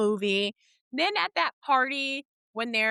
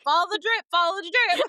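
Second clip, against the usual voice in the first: louder, higher mocking chant; imitating movie dad; 8 semitones higher, 5 dB louder, faster than usual. Quoting the dad clowning on the kids, playful imitation. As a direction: toss out the dad's taunt, high and loud. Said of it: "he was like".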